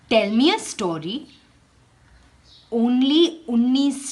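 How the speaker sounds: measured read-aloud for a class; teacher reading a picture book aloud; storybook reading. Acted. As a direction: tell the tale to children, unhurried.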